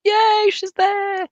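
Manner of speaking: high, loud, drawn-out yay; excited cheer. Real, not acted. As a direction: cheer slowly and high, stretching the vowels. Cheering caller's arrival.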